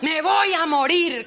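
high, slow, loud wail; mock despair. Preacher acting Elías wailing he will die. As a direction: stretch it out like a dying lament.

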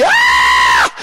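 sudden loud panicked cry; a burst of mock panic mid-sermon; acted cry of panic. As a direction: let out a short terrified yell. Flood scene, people panicking.

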